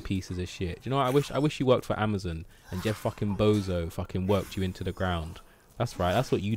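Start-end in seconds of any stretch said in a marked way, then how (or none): none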